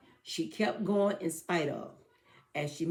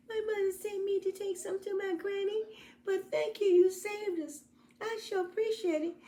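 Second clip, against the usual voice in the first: put-on very high, thankful little-girl voice; grateful girl. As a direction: thank him sweetly like a relieved child.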